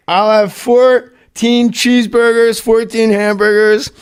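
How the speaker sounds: high, very slow, measured drive-thru order; Chris Farley. Retold or imitated. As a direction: imitate a proud customer drawing out each item. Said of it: "Farley pulls up to a drive-thru window and he goes".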